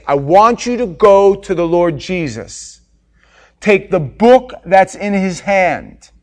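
loud, insistent divine command; commanding God voice. Real, not acted. God the Father sending John to the angel, booming. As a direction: order him to go, booming. Preaching at full force.

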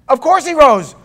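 high, loud, certain exclamation; Mary Magdalene insisting he rose; Mary's joyful certainty. Retold or imitated. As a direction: voice Mary sure of the resurrection. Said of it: "Mary Magdalene said".

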